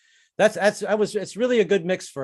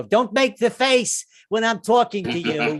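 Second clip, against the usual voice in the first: a hint of a scolding wife, higher and slow; mock nagging wife; 8 semitones higher, 4 dB louder, slower than usual. Wife scolding husband mid-argument, higher and slow. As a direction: scold him like an annoyed wife. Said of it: "she'll go".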